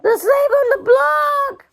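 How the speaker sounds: wife protests to husband, high and tearful; anguished cry. A narrator's reading. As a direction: cry out against losing her model. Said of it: "Anne cried".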